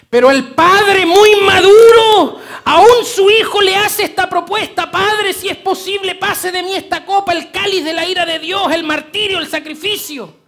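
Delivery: loud, high, ringing sermon voice; fervent pulpit cry; full-force preaching. Acted. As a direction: preach it out to the whole church.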